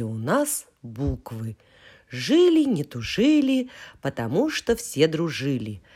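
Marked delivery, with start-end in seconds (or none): 2.1-3.7 high, bouncy telling; playful rhythmic lilt; lively storytelling lift. A narrator's reading. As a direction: lift the words up brightly. Sing-song opening of the tale.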